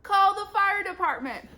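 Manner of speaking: loud, high-pitched yell. A narrator's reading. Principal calling for firefighters, yelling. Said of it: "Mister Klutz yelled".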